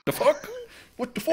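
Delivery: high-pitched, baffled mock reaction; mock bafflement. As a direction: act out confusion, rising high. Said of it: "give him a Canadian accent and be like".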